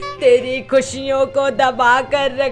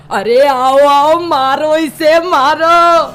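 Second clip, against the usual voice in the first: loud, rousing angry cry; enraged mother-in-law; 3 semitones higher, 10 dB louder, much slower than usual. Acted. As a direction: call the crowd in to beat him. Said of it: "पारा high हो जाता है".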